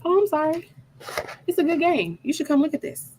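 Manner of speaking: high-pitched mock apology; imitated husband's excuse. Husband inviting angry wife to watch, mock innocence. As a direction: play the oblivious husband, pitched well up.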